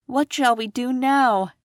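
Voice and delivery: put-on high, mildly sad girl's question; sad Dorothy. A narrator's reading. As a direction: ask it like a worried little girl. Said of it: "asked Dorothy, sadly".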